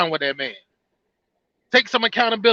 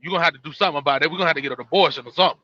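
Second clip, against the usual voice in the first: much lower and fast, brushing it off; dismissive man; 8 semitones lower, much faster than usual. Retold or imitated. Man told she's pregnant, low and fast brush-off. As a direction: rattle off a careless guy backing out. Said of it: "and he be like".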